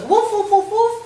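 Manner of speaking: high, loud woof woof barks; imitated barking. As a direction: bark like an excited big dog. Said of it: "and she goes".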